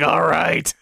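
lightly voiced frustrated villain; a hint of a fed-up cartoon villain; comedy villain voice. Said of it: "comedy villain voice".

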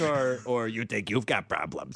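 low slow grumbling creature; cartoon animal voice.

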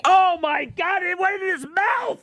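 usual speaking voice

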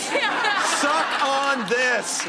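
drawn-out high taunting yell; mock gloating shout. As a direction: stretch out a mocking victory shout.

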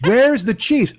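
high-pitched mock complaint; complaining video guy. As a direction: ask it as an indignant whine. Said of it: "guy goes".

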